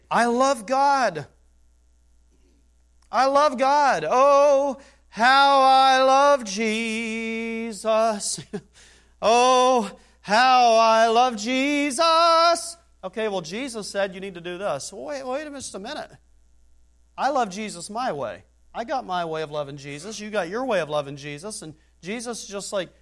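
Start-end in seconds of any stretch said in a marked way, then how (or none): 0.1-12.8 high, loud, drawn-out mock praise; mocking hypocrite. Mocking a churchgoer who claims love, high and drawn out. 14.9-20.2 mock excuse-maker. Imitating a self-excusing believer.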